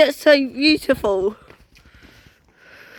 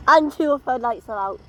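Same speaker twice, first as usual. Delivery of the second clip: normal talking voice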